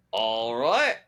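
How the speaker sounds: badly faked Australian with a questioning rise; terrible Australian accent. Actor accepting a silent fan's answer, in a bad Australian accent. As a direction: draw it out in mock Australian. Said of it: "I just did a terrible Australian accent".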